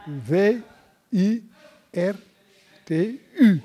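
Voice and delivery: letter by letter, a pause after each; separate, deliberate letters; spelled out. Shown as a demonstration. Spells out the word virtu.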